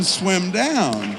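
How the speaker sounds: loud, drawn-out call; yelling. Retold or imitated. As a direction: imitate a child's yell, stretching the words. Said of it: "yelling, 'Swim down.'".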